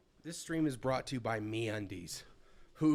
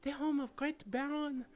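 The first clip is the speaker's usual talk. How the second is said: put-on high, girlish, anxious noblewoman; young lady voice; 12 semitones higher than usual. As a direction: ask about papa like a worried girl.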